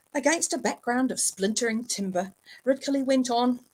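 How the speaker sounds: ordinary delivery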